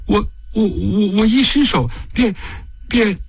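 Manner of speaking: distraught stammering. Acted. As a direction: stumble over the words, voice rising in distress. Breaks down confessing the killing.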